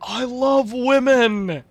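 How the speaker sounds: loud, slow, drawn-out proclamation; hammed-up shout. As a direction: bellow it like a joke declaration. Jokey exclamation, loud slow.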